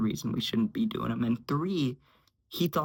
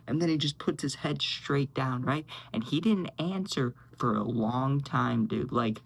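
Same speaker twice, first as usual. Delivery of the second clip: ordinary talk, nothing marked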